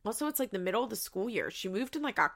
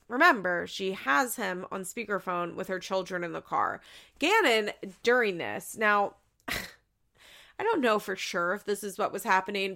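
usual speaking voice

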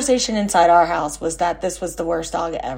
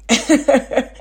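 high bright laugh breaking out; amused real laugh; real burst of laughter; 7 semitones higher, 4 dB louder than usual. Real, not acted. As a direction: laugh out loud at the twist. Reveals wrong dog.

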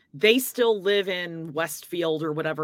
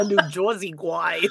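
hammed-up New Jersey "Joisey" accent; bad New Jersey accent. Joking about an actor's type, exaggerated New Jersey accent. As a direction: do a badly faked New Jersey tough-guy accent. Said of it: "that was a bad accent".

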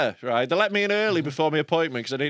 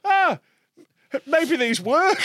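mock surprised realisation; 10 semitones higher, slower than usual. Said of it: "And I was like".

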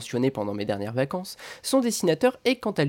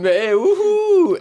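high, loud, drawn-out sarcastic whoop; mock cheer; 7 dB louder, much slower than usual. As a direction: cheer it ironically. Ironic cheer.

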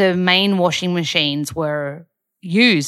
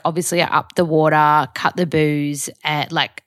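usual speaking voice

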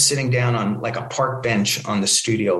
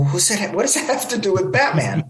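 higher-pitched, incredulous retold question; puzzled comeback, pitched up; baffled protest; 8 semitones higher than usual. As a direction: act out mock disbelief at the logic. Acted baffled reply to executive's verdict.

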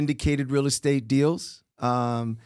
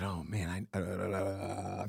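much lower, softer hesitant reply; sheepish mumble; 9 semitones lower, 13 dB quieter, much slower than usual. Retold or imitated. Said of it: "And I said".

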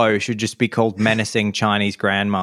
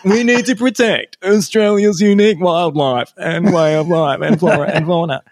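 higher, louder official broadcast voice, a laugh showing through; mock government announcement; 8 semitones higher, 5 dB louder than usual. Traveller mocking the arrival announcement on the plane, hammed-up official voice. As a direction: ham up a solemn airline safety message. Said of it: "suddenly it goes".

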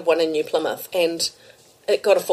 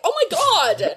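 giddy disbelief; 11 semitones higher than usual. Retold or imitated. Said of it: "I was like".

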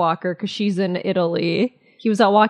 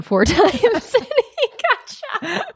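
higher, drawn-out words breaking into laughter; laughing through words; 12 semitones higher, much slower than usual. Real, not acted. Friends arguing over a news story, cracking up laughing. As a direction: lose it laughing mid-sentence.

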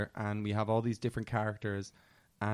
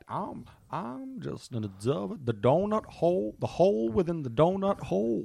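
hammed-up detective impression with a put-on accent; Daniel Craig; 7 semitones higher than usual. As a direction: stretch it into a lilting movie-detective accent. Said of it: "You're quite good at it".

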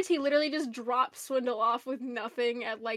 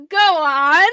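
very loud, drawn-out mock eagerness; suddenly interested; 3 semitones higher, 15 dB louder than usual. Ultra Magnus answering Swindle's offer, booming sudden interest. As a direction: boom it out as if hooked.

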